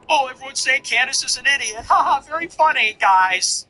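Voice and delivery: high, sarcastic imitation with fake laugh; mock seething. Mimicking her reply to being called an idiot, mock-seething. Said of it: "seething. She's like".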